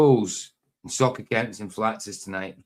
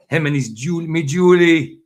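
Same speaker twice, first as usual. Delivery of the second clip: Ali G; 4 semitones higher, 8 dB louder than usual. Acted. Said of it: "Ali G in the house".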